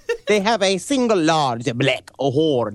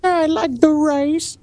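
squeaky dopey beast pleading; high silly creature voice; 11 semitones higher than usual. Pretend. Voicing the unicorn to Khan.

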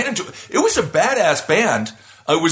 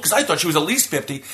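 ordinary talk, nothing marked

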